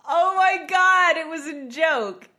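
high, slow, laughing oh my God; laughing as the joke lands; laughing disbelief. Real, not acted. Realizes the ghost pun.

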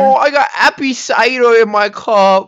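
high-pitched mock baby talk; whiny-cute toddler imitation to needle a friend; mocking little kid. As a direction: tease in a put-on small child's voice, high and loud. Mocks friend for drinking apple cider.

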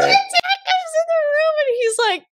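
very high, squeaky with laughter; cracking up. Real, not acted. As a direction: tell it while helplessly laughing. Cracks up retelling dad's entrance.

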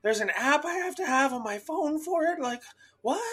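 put-on high-pitched, incredulous old-lady voice; bewildered old lady. Old lady learning of the app, high and bewildered.

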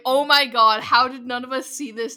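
very loud retold astonishment; jaw-dropped amazement. Podcaster retelling the table's reaction to a twist, loud amazement. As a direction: burst out as if the twist just landed. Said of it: "like, jaw-dropping".

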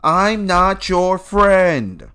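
high, loud, very drawn-out show quote; quoted TV catchphrase. Retold or imitated. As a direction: stretch it out like a catchphrase. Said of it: "what was that thing?".

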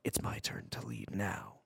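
low, whispered villain aside; scheming whisper. Retold or imitated. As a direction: whisper it as a secret mock plot. Scheming aside after fake alarm.

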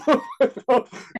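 sudden high laugh; a real amused laugh; high burst of laughter. Real, not acted. Laughs at own story.

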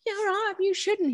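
high, soft hammed-up fragile girl; dainty mock-worried girl voice; mock damsel. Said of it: "She's like".

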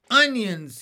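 loud, high shout of delight; Henry smelling the stew, shouting with joy; happy shout. Acted. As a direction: call it out as you run up. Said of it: "he shouted".

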